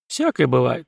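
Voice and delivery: ordinary delivery, nothing marked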